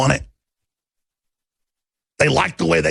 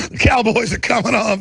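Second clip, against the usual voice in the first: usual speaking voice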